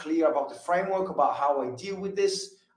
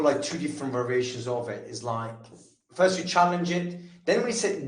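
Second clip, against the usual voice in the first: ordinary talk, nothing marked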